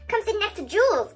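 calling out. A narrator's reading. Girl inviting a classmate over to her friend, calling out. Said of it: "I called".

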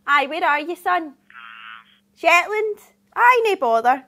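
loud, cheery broad Scottish phone voice; broad scottish accent. Acted. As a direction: greet the caller like a mate, Scottish. Another phone booking mid-pitch.

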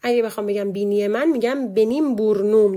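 ordinary talk

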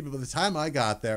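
ordinary delivery

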